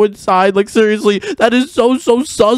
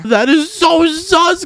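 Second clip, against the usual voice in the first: incredulous; 10 semitones higher, much slower than usual. Acted. Reacting to kiss the bride.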